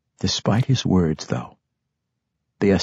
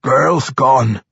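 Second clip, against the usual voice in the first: loud, raised dwarf grumble; grumbling; 6 semitones higher, 5 dB louder than usual. A narrator's reading. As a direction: grumble the bad news as a dwarf. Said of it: "Pwent managed to grumble".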